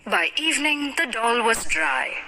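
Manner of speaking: plain reading voice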